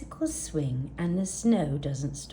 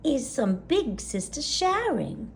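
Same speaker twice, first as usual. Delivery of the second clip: granny voice; 6 semitones higher, 4 dB louder than usual. Acted. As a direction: coo it like a gentle old granny. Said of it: "Gran nodded".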